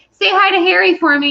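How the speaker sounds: mock child's request; imitated child. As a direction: pipe up like an eager little kid. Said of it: "if a child comes up to you".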